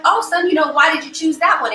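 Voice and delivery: lightly voiced mom, pitched high; a hint of high mommy voice; mother to little child. Mother voiced asking her child.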